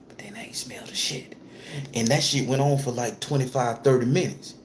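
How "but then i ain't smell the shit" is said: hushed unvoiced whisper. Real, not acted. Storyteller tells viewers how the pain swapped with the smell, whispering. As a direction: let it drop to a secret.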